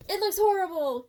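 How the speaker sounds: high, loud crying wail; mock wail. Real, not acted. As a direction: wail at the result. Reveal, wailing.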